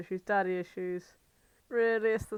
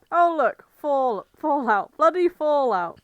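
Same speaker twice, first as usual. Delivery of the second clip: much louder, higher, thrilled find; excited; 5 semitones higher, 12 dB louder than usual. Real, not acted. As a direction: blurt it out, delighted to spot it. Spotting Fallout in a list.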